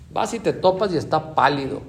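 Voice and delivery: normal talking voice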